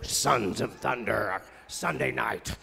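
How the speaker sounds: deep, slow, loud trailer voice; in a big TV promo voice, deep and slow; movie-trailer announcer. Acted. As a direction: boom it like a TV promo. Announcing a TV show title.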